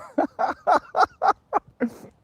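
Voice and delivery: very high, loud burst of laughing; real laughter. Real, not acted. Streamer laughs at Boone's blood vessel joke, helpless laughter.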